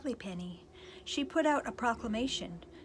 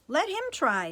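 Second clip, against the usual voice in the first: loud, higher command; 7 semitones higher, 8 dB louder than usual. Acted. Princess commands the brothers to let Cinders try. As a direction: order them firmly. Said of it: "the princess commanded".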